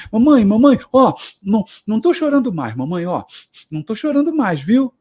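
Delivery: put-on high childish pleading to mom; a little boy begging mom to notice; child's plea. Small son reassuring his mother he stopped crying, pleading.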